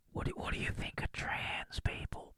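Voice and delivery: hushed conspiratorial whisper; a sly whispered screening question. Pretend. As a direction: whisper it like a secret test. Casting question whispered secretly.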